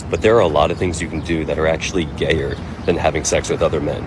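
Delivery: normal talking voice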